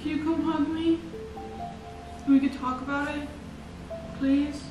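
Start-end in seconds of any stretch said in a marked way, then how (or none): none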